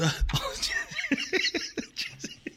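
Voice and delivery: sudden squealing laugh; high burst of laughter. Real, not acted. Laughs at video title.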